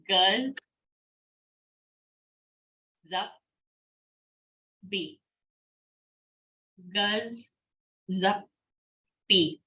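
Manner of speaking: "guz", "zup", "bee" with long pauses between; slow broken-up teaching of "guz, zup, bee"; syllable by syllable. Shown as a demonstration. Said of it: "So all together".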